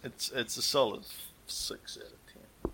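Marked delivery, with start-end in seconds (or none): 1.5-2.7 breathy whispered aside. Real, not acted. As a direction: whisper it like a confession. Whispered verdict on movie.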